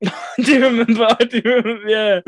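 laughing through the words; chuckling as you recall it. Real, not acted. Laughing at shared memory.

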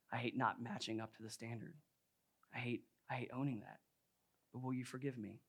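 hushed, low retold admission; contrite confession. Wife admits her struggle to husband, contrite confession. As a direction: confess softly, as in a retold apology. Voices wife's confession and apology.

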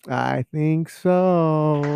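very slow and drawn out; slow mock-smug answer. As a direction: answer yourself smugly, slowly.

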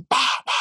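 high-pitched "pa, pa" smacks; imitated belt smacks. Imitating belt blows in an anecdote.

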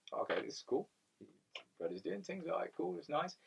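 quiet, awkward mock calm; hushed and uneasy, pretending it's fine; awkward acceptance. Telling a friend, hushed awkward calm.

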